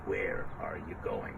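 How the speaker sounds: low storybook wolf voice; big bad wolf. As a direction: drop low like a lurking wolf. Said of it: "the big bad wolf says".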